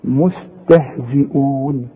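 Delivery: مستهزئون slow with thin letters; tajweed demo of مستهزئون; light recitation. Shown as a demonstration. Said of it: "برضو مرققة".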